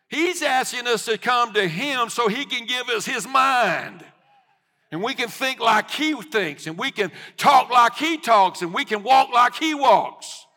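loud, high-pitched preacher's cry; full-force preaching. Real, not acted. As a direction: drive the sermon home at full voice.

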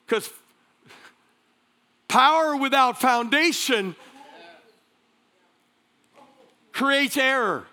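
punchy sermon delivery with weighted key words; emphatic preaching. Real, not acted. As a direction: preach it to the whole room.

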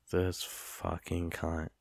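quiet disbelief. Retold or imitated. Man cursing the kid who switched the keg, quiet disbelief. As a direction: say it slowly under your breath, word by word. Retold reaction on spotting wrong keg.

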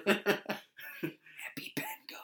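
drawn-out, voiceless whispered cheer; root for the player in a slow whisper. Retold or imitated. Imitating game watching while parents sleep, whispering. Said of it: "quarter whihispering".

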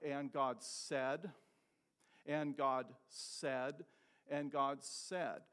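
"And God said" drawn out slowly, stress on God; preacher slows to let the phrase ring; slow repeated refrain. Shown as a demonstration. Preacher drills the congregation on the refrain, slow and emphatic. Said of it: "And God did what?".

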